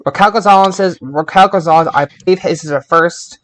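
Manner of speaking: ordinary talk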